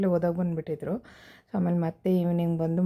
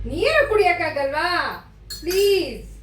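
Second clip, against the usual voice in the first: pleading; 10 semitones higher, 5 dB louder than usual. Real, not acted. Mother pleading with child to drink.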